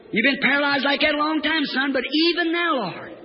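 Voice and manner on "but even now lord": soaring pulpit refrain; preacher's faith cry. Acted. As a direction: stretch it high, then fall away. Same refrain.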